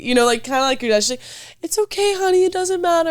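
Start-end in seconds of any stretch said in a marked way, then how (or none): 1.6-3.1 high-pitched, cooing mock mom; too sweet. Mom consoling her kid after the game, exaggeratedly sweet. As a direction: imitate a sugary, consoling mother. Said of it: "Mom would just be too sweet".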